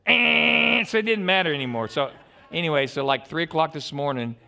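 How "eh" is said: loud high croaking eh; frog croak imitation. Preacher imitates the frog croaking at night, loud croak.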